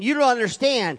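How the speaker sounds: firm rebuke that "don't" lands on; God's voice. Retold or imitated. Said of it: "God is saying".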